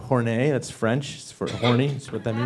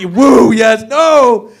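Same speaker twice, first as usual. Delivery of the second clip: mock cheer; 9 semitones higher, 10 dB louder, much slower than usual.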